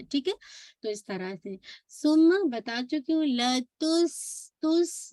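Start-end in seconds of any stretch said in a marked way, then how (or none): 3.3-5.1 लतुस drawn out into a hissing स; whistling S. Shown as a demonstration. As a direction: stretch लतुस, then whistle the S of तुस. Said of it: "whistling sound आ रही है". (लतुस में सीटी जैसी स, खींचकर)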